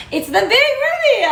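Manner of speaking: big high excited announcement; showman's reveal. Real, not acted. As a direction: build up the reveal with delight. Unveiling the bread.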